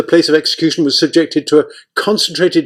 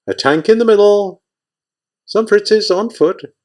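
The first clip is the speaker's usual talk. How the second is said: high, lilting sing-song lookout report; 7 semitones higher than usual. Acted. Soldier calls out what he sees in a high sing-song. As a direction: chant the sightings up high, like a song. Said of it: "report in a sing-song voice".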